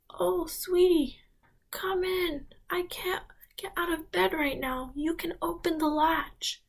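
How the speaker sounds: a bedridden granny welcoming her visitor; frail grandmother. Acted.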